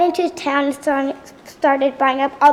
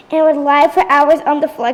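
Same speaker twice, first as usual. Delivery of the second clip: usual speaking voice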